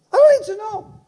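sing-out high repeat of the point; high-pitched exclamation. Acted. Preacher repeats to congregation he needn't know, high exclamation. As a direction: leap up high on the whole phrase.

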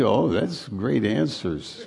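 ordinary talk

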